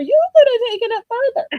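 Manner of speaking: high, fast repeat of the urging; pitched high, quick encouraging echo; excited insistence. Retold or imitated. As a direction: still way up, rushing the words. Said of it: "And I'm like".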